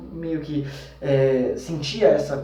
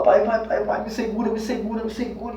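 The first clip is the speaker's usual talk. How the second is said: desperate plea; 6 semitones higher than usual. Retold or imitated. Clinging harder, desperate.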